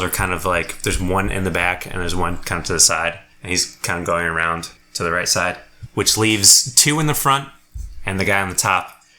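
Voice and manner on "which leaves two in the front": usual speaking voice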